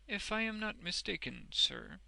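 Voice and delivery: hint carefully, ending in a question; delicate hint. A narrator's reading. Wegg fishing for Boffin's offer, delicately hinting. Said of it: "Mister Wegg delicately hinted".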